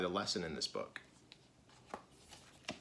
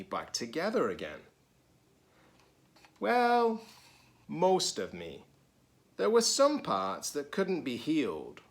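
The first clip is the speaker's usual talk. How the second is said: lively, higher storybook reading voice; reading to children; 5 semitones higher, 5 dB louder than usual. A narrator's reading. Teacher reading a picture book to children, lively. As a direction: read it brightly to the kids.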